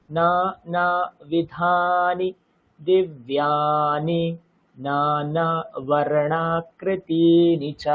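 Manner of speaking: নানাবিধানি with its long া drawn out; long syllables held. Shown as a demonstration. Teacher showing which syllables are দীর্ঘ. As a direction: slowly hold each long vowel. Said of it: "এগুলো দীর্ঘ".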